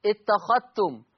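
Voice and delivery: اتخذتم said "ittakhattum", no ذال sound; اتخذتم with ذال assimilated into the تاء; ذال merged into تاء. Shown as a demonstration. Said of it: "لا يتلفظ بالذال".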